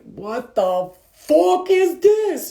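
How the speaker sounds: slow, loud, high outrage at arrival; newly arrived soul appalled by Earth; shocked disbelief. Retold or imitated. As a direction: stretch out the horror of landing here. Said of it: "you're like".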